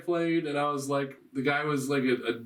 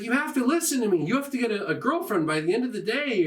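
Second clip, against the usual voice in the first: higher, pleading cupid character; urgent cupid girl; 7 semitones higher, faster than usual. Retold or imitated. Cupid girl orders the guy to find a girlfriend, urgent. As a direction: imitate a cupid girl insisting, pitched up.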